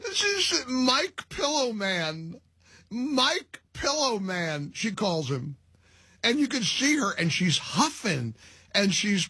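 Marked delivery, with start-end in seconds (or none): 0.1-4.7 laughing. Real, not acted. Host mimics her phrase, laughing.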